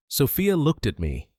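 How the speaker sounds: ordinary delivery, nothing marked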